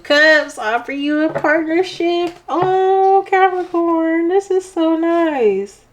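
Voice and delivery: high, drawn-out gushing; gushing delight. Real, not acted. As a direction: draw it out high, moved and delighted. Moved by a lovely card.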